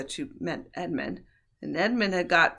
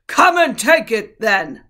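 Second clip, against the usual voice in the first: much louder, higher bellowing roar; bull bellow; 5 semitones higher, 15 dB louder, slower than usual. A narrator's reading. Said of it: "in a great bellowing voice".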